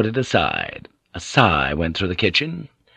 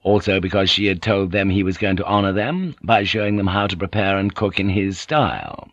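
ordinary delivery, nothing marked

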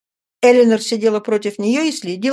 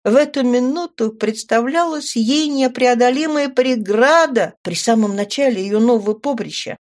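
ordinary delivery

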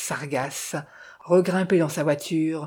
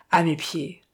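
ordinary delivery, nothing marked

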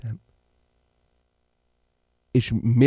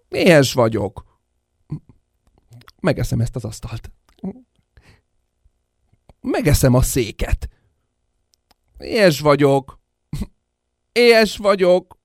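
put-on hungry baby demand; 6 dB louder than usual. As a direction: bellow it out like a starving infant. Giant baby demanding food, repeated.

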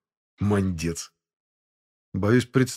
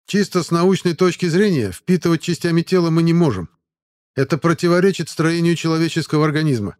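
rattled off; 8 semitones higher, 6 dB louder than usual. A narrator's reading. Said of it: "оттараторил [name]".

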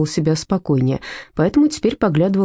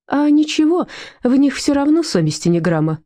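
snorted retort; 9 semitones higher than usual. Acted. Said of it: "фыркнула Людмила".

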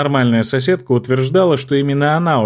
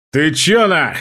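barking; 4 semitones higher, slower than usual. Acted. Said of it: "рявкнул старший брат".

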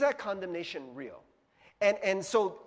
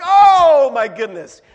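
much louder, slow drawn-out exclamation; shocked outburst; 6 semitones higher, 22 dB louder, slower than usual. Retold or imitated. Nearly stepping in the dog's mess, a much louder slow exclamation. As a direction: blurt it out in disgust. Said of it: "I'm like".

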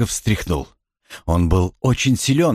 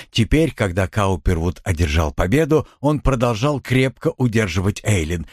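usual speaking voice

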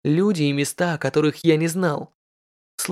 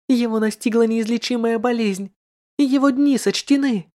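high, bitter envious lady; a spiteful rich lady pouring out envy; jealous noblewoman; 7 semitones higher than usual. Acted. Jealous lady in red speaks. (ревнивая знатная дама)

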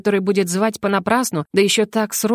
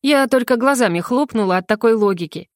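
plain reading voice

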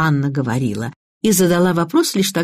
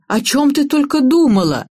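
much higher, exclaimed reproach; reproachful; 9 semitones higher, 3 dB louder than usual. A narrator's reading. Mother scolds daughter for her rashness, reproachful. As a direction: raise the pitch in dismayed scolding.

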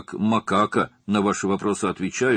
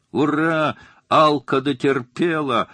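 higher, louder tipsy whoop; drunken laughing cheer; 9 semitones higher, 7 dB louder, slower than usual. A narrator's reading. A drunk guest laughs and cheers from the room. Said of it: "пьяный смех".

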